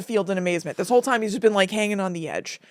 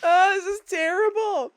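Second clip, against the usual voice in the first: higher, teary whimper; real crying; 9 semitones higher than usual. Real, not acted. Host crying at the trees scene, sobbing. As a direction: cry it out. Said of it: "laughing and crying simultaneously".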